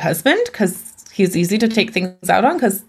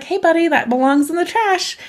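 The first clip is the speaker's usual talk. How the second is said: put-on higher, bright parent-to-toddler voice; talking to a kid; 9 semitones higher than usual. Parent gently telling a little kid to bin the wrapper, higher. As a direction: coax a small child kindly. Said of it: "you'd be like".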